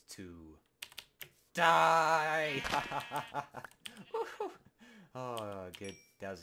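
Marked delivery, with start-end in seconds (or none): 1.5-2.6 high, loud gleeful shout; battle cry. Acted. Killing sheep again. 2.7-4.6 villain cackle. Acted. Laugh after kill.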